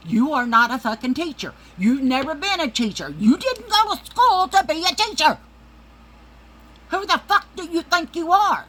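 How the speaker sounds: high, loud outraged yelling; rising into a furious high-pitched tirade; furious rant. Real, not acted. Ranting at her sister about parenting, furious high yell.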